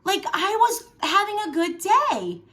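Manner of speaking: high, loud and outraged at a stranger; a sudden 'are you kidding me' at the lady; exasperated surprise. Retold or imitated. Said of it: "And then you're like".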